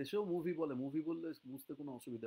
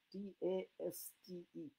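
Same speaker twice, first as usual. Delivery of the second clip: spelling aloud; 2 semitones higher, much slower than usual. Shown as a demonstration. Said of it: "test মানে হচ্ছে স্বাদ".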